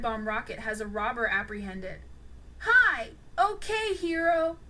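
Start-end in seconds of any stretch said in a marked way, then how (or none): 2.6-4.6 high, loud mocking jeer; taunting. A narrator's reading. Unmasked robber taunting the hero, mocking and high. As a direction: needle the hero who caught him. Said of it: "taunted the robber".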